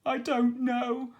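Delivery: pirate captain telling how his ship was taken; Captain Stubble voice. A narrator's reading. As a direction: play the distraught old pirate.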